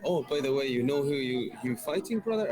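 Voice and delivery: imitating the manager's German accent, pitched high; a playful German accent, rising up high; lightly put-on German manager voice; mock German accent. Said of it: "he was like".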